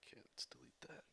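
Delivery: soft unvoiced whisper. Real, not acted. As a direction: whisper under your breath. Muttering to himself while drawing.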